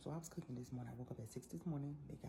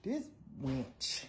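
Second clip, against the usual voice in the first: laughing; 5 semitones higher, 5 dB louder than usual. Real, not acted. Laughing at her own insult.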